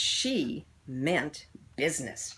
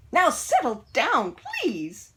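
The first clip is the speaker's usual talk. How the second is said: a sweetly coaxing teacher, pitched up; 9 semitones higher, 8 dB louder, slower than usual. A narrator's reading. Miss Nelson coaxing her class, sweet and high. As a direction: gently ask the class to settle. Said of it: "Miss Nelson said in a sweet voice".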